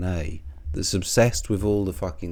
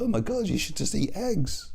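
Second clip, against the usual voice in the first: a high, lightly put-on sneer; mocking whiny voice; 9 semitones higher, 3 dB quieter than usual. Vlogger mocking egg-diet critics, high and sneering. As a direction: parody the egg-diet crowd. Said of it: "they attack, like, eating porridge".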